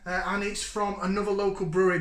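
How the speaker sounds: ordinary talk, nothing marked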